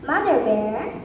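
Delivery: ordinary delivery, nothing marked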